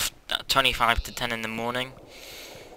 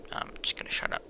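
low, soft whisper; 11 dB quieter, faster than usual. Acted.

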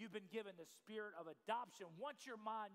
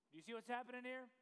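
ordinary talk, nothing marked

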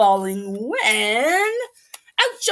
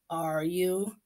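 whisper; 4 semitones lower, 7 dB quieter than usual. Acted. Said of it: "papa whispers the question".

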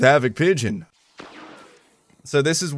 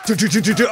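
mouth gunfire; 8 semitones higher, 4 dB louder, faster than usual. Real, not acted.

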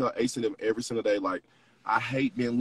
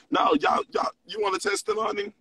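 excited call out. Real, not acted. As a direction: call the room to attention, thrilled.

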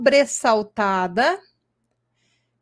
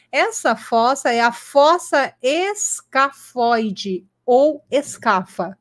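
slow dictation; slower than usual. Shown as a demonstration. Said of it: "Vou falar bem devagar".

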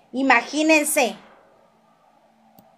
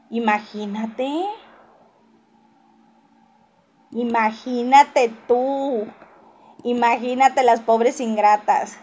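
slow mock pity; slower than usual. Real, not acted.

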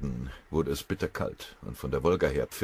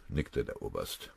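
usual speaking voice

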